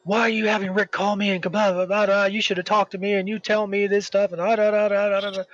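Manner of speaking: lightly voiced angry lady; mock rant. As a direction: mimic her ranting. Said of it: "starts going freaking off on me".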